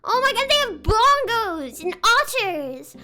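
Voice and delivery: excited gushing. Real, not acted. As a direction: rave about each animal like it's unbelievable. Excitedly listing Dubbo zoo animals.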